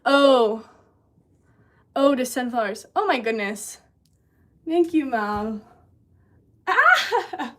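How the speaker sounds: loud happy exclamations over a gift; excited. Real, not acted.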